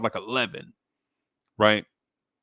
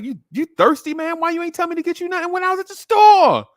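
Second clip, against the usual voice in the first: put-on high, loud, indignant kid's complaint; whiny kid; 7 dB louder than usual. Boy complains to his uncle about another errand, high and indignant. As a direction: protest like a put-upon little boy.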